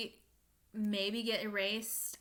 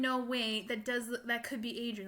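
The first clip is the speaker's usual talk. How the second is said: ordinary talk, nothing marked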